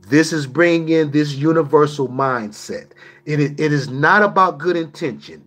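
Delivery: usual speaking voice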